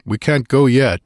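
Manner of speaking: curt grunted refusal; brusque hero putting off a plea; gruff grunt. A narrator's reading. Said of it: "he grunted".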